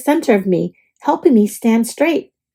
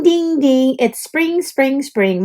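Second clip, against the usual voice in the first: ordinary delivery, nothing marked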